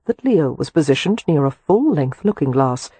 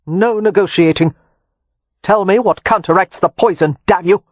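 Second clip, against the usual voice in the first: louder barked demand; no bargaining, hammer it; angry demand; 3 semitones higher, 5 dB louder than usual. A narrator's reading. Leo refusing to negotiate with Harrow, angry demand.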